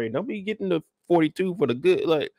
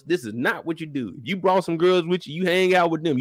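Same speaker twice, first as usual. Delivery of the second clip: normal talking voice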